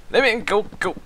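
high, put-on Welsh accent. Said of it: "how do you do with a Welsh accent?".

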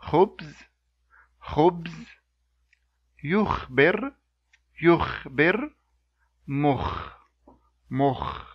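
"khubz", "yukhbir", "mukh" with a raspy velar kh; Arabic kha words. Shown as a demonstration. Teacher modelling kha example words for learners. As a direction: rasp the kh in the back of the mouth.

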